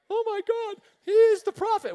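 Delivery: high loud mock awe; high-pitched mock reverence; awed pastors. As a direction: squeal it like amazed pastors. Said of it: "the pastors there are like".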